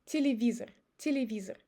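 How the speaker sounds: Телевизор as ti-li-VEE-zer; E as i and final O as uh in Телевизор; reduced vowels. Shown as a demonstration. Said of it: "I change Е to И and O to Э".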